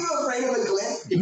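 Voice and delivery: high squealing mock question through laughter; giggling high-pitched disbelief; laughing tease. Real, not acted. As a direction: crack up while teasing him. Mocking friend's fear, laughing.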